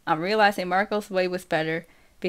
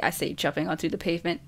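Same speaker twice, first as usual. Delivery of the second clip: plain reading voice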